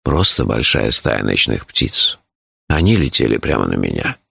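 deep, composed character voice; low, measured, matter-of-fact telling; phlegmatic Shurf. A narrator's reading. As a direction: explain danger with unruffled calm.

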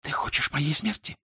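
high, hushed female question; whispering. A narrator's reading. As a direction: whisper it in reproach. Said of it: "она шепнула".

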